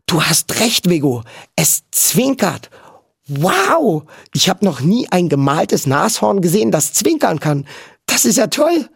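put-on high-pitched boyish amazement; excited calling out. A narrator's reading. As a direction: marvel aloud with delight. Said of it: "rief Topper". (begeistert staunend)

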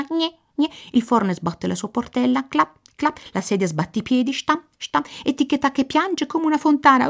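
high, fast Italian rattle; frantic raven. Acted. The raven explains the racket to the gnome, high and fast. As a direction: rattle off the whole story breathlessly.

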